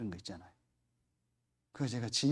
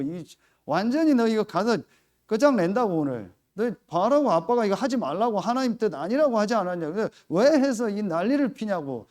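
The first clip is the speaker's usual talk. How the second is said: loud, higher angry yelling at the daughter; father yelling at his daughter over lunch; furious scolding; 5 semitones higher, 6 dB louder than usual. Retold or imitated. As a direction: tear into her at the table.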